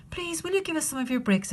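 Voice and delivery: little wolves begging politely; little wolves asking. Acted. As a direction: ask sweetly like small cubs. Wolves politely ask kangaroo.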